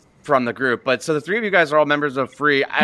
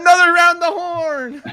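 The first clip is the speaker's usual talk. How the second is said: high, shouted game show cry; mock host call; 4 dB louder than usual.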